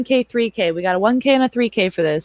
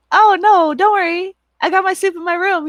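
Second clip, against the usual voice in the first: higher, quick, sing-song brush-off; mock-innocent mom; 11 semitones higher, 4 dB louder than usual. Mom telling her son she has her own soup, high and mock-sweet. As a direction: sweetly wave him off, hiding the joke. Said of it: "And I'm like".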